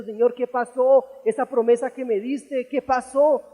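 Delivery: imitating a distraught, pleading man; retold distressed plea. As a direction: cry out to God in mock despair. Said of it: "estaba llorando, estaba tirado en el suelo diciendo".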